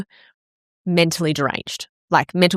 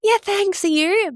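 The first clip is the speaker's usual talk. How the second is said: high, sing-song social pleasantry; mock polite reply; slower than usual. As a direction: put on a high cheery front. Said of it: "of course, I went".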